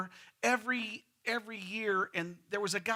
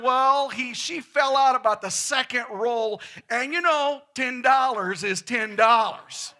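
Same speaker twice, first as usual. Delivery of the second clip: louder and higher, slow punchline; loud deadpan answer; 5 semitones higher, 8 dB louder, slower than usual. Retold or imitated. Husband shouting back to the pilot, loud and slow. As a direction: deliver the punchline big and slow.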